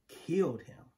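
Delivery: usual speaking voice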